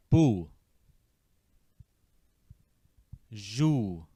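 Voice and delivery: "Boo" and "Jou" drilled slowly, one syllable at a time; "Boo"... "Jou" as a call-and-repeat drill; repeat after me. Shown as a demonstration. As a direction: model each syllable with long pauses. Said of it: "Try this word with me now".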